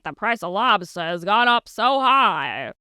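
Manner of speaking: put-on Maine accent. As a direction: put on a thick Maine accent for a pressed-button clip. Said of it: "I would taxidermy the main accent".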